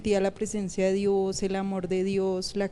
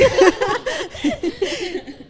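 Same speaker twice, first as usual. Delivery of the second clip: very high loud laugh; 14 semitones higher, 6 dB louder than usual. Real, not acted. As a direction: burst out laughing. Ríe por la propuesta de matrimonio. (carcajada muy aguda)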